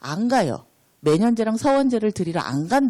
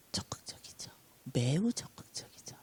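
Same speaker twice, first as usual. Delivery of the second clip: whispered; 5 semitones lower, 12 dB quieter than usual. Real, not acted. Answering softly in sermon.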